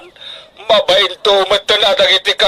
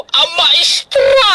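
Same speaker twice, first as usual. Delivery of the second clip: overjoyed shout; 13 semitones higher, much slower than usual. Acted. Father crowing that his child will be a teacher, drawn-out proud shout. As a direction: stretch out the word in triumph.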